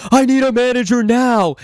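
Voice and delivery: high, strained pretend cry for help; mock scream. Clerk calling for a manager by radio, mock screaming. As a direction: imitate panicked screaming into a radio. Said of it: "started screaming into my radio".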